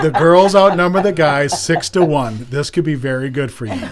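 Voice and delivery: laughing at his own joke; laughing while talking. Real, not acted. Dad joking to son about cheer.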